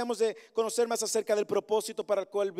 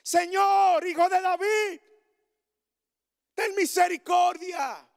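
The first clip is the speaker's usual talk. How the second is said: very high, loud shout, drawn out slowly; the congregation shouts over the crowd to Jesus; shouting louder; 10 semitones higher, 7 dB louder, slower than usual. Shown as a demonstration. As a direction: cry it out at full voice over everyone. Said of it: "empecemos a gritar más alto".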